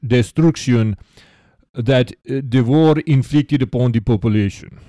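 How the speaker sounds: hammed-up Russian accent, imitating a letter writer; American's fake Russian accent. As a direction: read it out in a put-on Russian accent. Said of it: "like an American trying to do a Russian accent".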